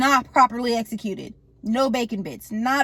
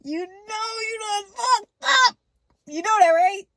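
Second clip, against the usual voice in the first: shouted accusation; 10 semitones higher than usual. Retold or imitated. Killer to the artist at the opened door, high shouted accusation. Same line repeated as a shout.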